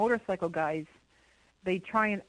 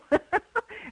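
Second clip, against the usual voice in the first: laughing; 13 semitones higher, 4 dB louder than usual. Real, not acted. Laughing after the whoop.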